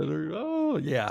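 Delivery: downplay it with a light high-voiced shrug; lightly voiced sheepish agreement; mild mock reply. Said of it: "I may have said".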